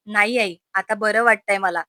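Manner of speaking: ordinary delivery, nothing marked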